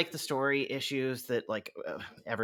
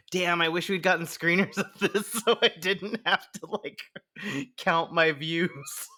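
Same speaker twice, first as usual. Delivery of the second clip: playful mock regret, pitched up; amused exclamation; 6 semitones higher than usual. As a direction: lament it through a laugh. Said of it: "I was like".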